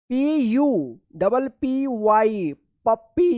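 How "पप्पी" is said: पप्पी said with a short a sound, puh-pee; teacher models पप्पी clearly for students; correct pronunciation. Shown as a demonstration. Teacher to students, showing the right sound of पप्पी. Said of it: "इसको पुप्पी मत पढ़िएगा".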